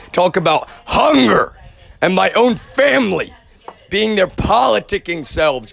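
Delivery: high-pitched, real exasperation; vent, pitching the key words high; exasperated outburst. Real, not acted. Angry rant about own family.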